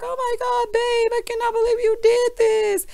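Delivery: very high-pitched gushing wife; mock excitement. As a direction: imitate an overjoyed squeal at a surprise. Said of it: "she was so excited".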